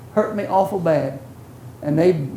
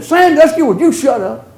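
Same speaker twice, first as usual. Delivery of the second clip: higher, louder retold annoyance; mock irritated snap; 5 semitones higher, 4 dB louder than usual. Muddy friend snapping at the preacher, mock annoyed. Angry friend covered in mud.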